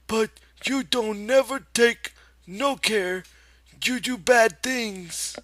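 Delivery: very high, loud, slow scolding; a stern old aunt wagging her finger; scolding aunt in Lenny's voice. A narrator's reading. Aunt Clara scolds Lenny, very high and loud in his voice. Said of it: "it was in Lenny's voice".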